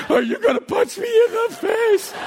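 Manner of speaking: put-on very high squeaky toddler voice; alarmed small child. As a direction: ask dad like a worried little kid. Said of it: "he's two and a half".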